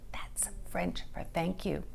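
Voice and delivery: soft confiding whisper. A narrator's reading. Narrator whispering a French translation. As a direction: whisper it behind a hand.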